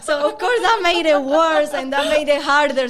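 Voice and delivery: high, loud laughter through the words; laughing loudly. Real, not acted. Guest laughing about her mom's guesses, loud and high. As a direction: talk through a big laugh.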